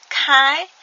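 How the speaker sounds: teacher models Khai clearly; Khmer word model. Shown as a demonstration. Teacher to learners, modelling Khai clearly. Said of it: "Le premier mot correspond".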